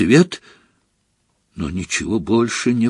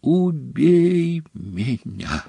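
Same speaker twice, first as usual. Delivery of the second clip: slow, high, drawn-out plea; anguished wail; 5 semitones higher, 5 dB quieter, much slower than usual. A narrator's reading. Dumbledore after the last gulp of potion, anguished wail. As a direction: wail it slowly in torment, without volume. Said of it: "завопил".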